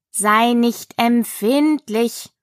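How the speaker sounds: slow, syllable-by-syllable admonition; ponderous caterpillar rebuke; languid caterpillar. Acted. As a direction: draw out each syllable unhurriedly. Caterpillar admonishes Alice slowly.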